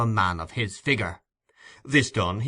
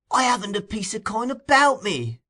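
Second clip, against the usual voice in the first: put-on much higher, whining old miser; 12 semitones higher than usual. A narrator's reading. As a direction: plead poverty in a thin whine.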